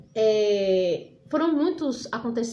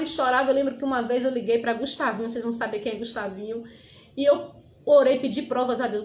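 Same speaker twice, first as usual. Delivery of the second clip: ordinary talk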